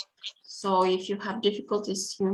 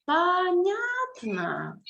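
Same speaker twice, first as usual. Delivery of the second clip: "Понятно" very slow, first vowels held high, then dropping; slow, high model pronunciation of "Понятно"; drawn-out syllables; 10 semitones higher, much slower than usual. Shown as a demonstration. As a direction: stretch each of the three syllables in "Понятно". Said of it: "it's a three syllable word".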